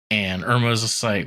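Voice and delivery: ordinary talk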